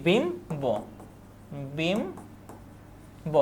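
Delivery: বিম্ব drawn out, a long gap before bo; unaltered b. Shown as a demonstration. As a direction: say বিম্ব very slowly, "bim... bo". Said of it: "এভাবে হবে উচ্চারণ".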